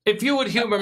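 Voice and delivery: house elf voice. Acted. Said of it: "sound like a house elf".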